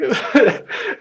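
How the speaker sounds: laugh. Real, not acted. Laughing at son's line.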